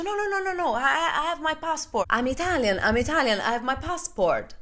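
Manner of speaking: showing her passport to the suspicious soldier; frantic protest. Retold or imitated. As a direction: beg them high and insistently to believe you.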